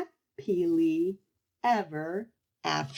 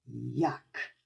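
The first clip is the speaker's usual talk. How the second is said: low, soft, disgusted yuck; 4 semitones lower, 4 dB quieter than usual. Acted. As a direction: spit out the word in disgust. Child forced to eat candy.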